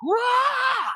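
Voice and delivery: very high, loud mock shriek; startled yelp. Patient when doctor checks her, shocked mock yelp. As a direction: yelp as if suddenly invaded.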